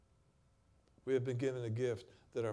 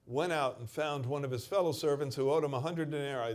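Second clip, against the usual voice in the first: louder, driving scripture reading; forceful preaching; 3 semitones higher, 6 dB louder than usual. Acted. Preacher tells the congregation the servant's hypocrisy, loud. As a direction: push the story toward the turn.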